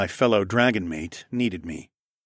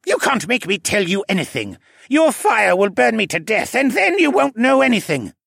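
high petulant protest, growing louder; pouting; 12 semitones higher, 5 dB louder than usual. A narrator's reading. Assassin defies his interrogator's fire magic, pouting. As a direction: refuse like a sulking captive.

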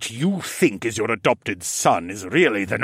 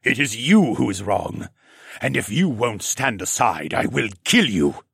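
cold threat. A narrator's reading. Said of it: "a tone even colder".

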